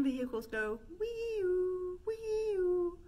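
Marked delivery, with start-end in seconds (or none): 2.1-3.0 high wailing wee-oo siren; siren noise. Shown as a demonstration. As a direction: wail like an ambulance. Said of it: "push your lips out and go wee-oo".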